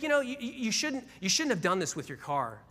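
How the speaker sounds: high, scolding mock clerk voice. DMV clerk scolding the new car owner, high mock scold. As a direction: pitch it up and wag a finger at him. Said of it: "she's like".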